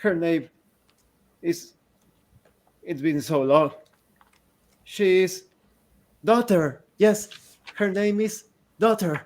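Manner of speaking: cling to the one word daughter; a lost mind repeating daughter; confused grieving mother. Acted. Mad woman answers her daughter's name.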